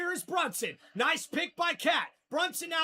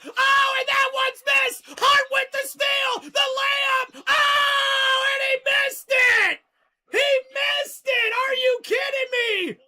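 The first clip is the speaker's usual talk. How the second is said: loud, high disbelief at a miss; exasperated shout; 5 semitones higher, 6 dB louder than usual. Real, not acted. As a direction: yell at the blown layup. Hart misses easy layup.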